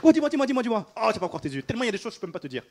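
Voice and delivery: high, loud, breathless amazement; amazed exclamation. Pretend. Preacher acting out a joyful churchgoer, amazed. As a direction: gush like someone who can't believe it.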